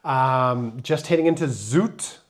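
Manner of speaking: usual speaking voice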